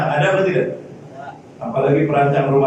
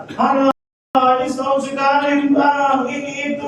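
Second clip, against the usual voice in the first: lightly acted higher-pitched nagging; higher mock wheedling; pleading spouse; 9 semitones higher than usual. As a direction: plead as a spouse wanting a once-a-year treat.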